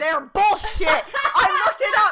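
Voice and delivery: loud, high, laughing outburst; loud declaration. Real, not acted. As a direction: blurt it out loudly. Emphatic debunking shout.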